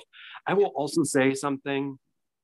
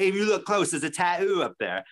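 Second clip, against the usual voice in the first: higher mock British voice; British accent; 6 semitones higher than usual. Imitating the tattooed man explaining himself, high British accent. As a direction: put on a British accent, pitched up. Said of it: "doing a British accent".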